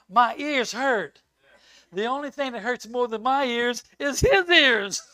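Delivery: loud, slow, punched joke; teasing a chatty friend in front of the church; punchline. Acted. Joking about talkative friend.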